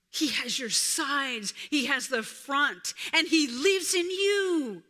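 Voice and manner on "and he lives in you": higher, louder proclamation; fervent sermon climax; preaching at full force. Real, not acted. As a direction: build to a triumphant cry.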